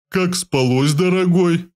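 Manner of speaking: a touch of sarcastic sweetness on дорогой; mocking tone. A narrator's reading. Big man mockingly asks the hero how he slept. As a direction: tease him about his sleep. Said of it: "Издевательским тоном поинтересовался здоровяк".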